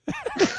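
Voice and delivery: sudden high-pitched laugh; short high laugh at the joke; high burst of laughter. Real, not acted. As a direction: break into a squeaky laugh.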